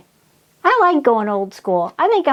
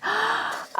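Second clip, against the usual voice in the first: sharp soft intake of breath; delighted gasp; 10 semitones higher, 9 dB quieter than usual. Real, not acted. Crafter seeing her clock finished, delighted gasp.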